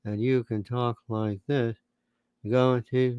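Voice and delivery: mock lifeless drone; boring pundit. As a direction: drone it like a deathly dull TV guest.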